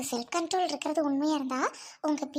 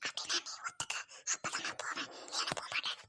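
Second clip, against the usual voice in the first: whisper; 10 semitones higher, 12 dB quieter than usual. Acted. Bickering secretly within earshot.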